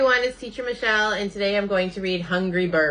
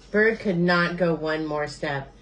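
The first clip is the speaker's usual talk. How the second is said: ordinary delivery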